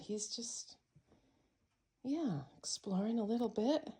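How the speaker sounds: hushed whisper so the cat isn't startled. Real, not acted. Owner whispering while the new cat explores. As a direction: breathe it out under your breath.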